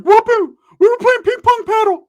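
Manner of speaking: very high, loud goofy cousin voice; exaggerated high-pitched imitation; Ricky. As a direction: squeal it excitedly down the line. Ricky comes to the phone.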